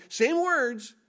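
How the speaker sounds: usual speaking voice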